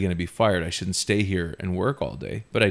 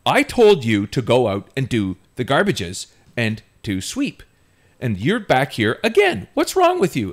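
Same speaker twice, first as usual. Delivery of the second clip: loud, high, exasperated boss scolding; in-your-face scolding; 5 semitones higher, 6 dB louder than usual. Retold or imitated. Boss confronting dishwasher back in the kitchen, angry scolding. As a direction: get in the kid's face and let the pitch climb. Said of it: "she got right in my face".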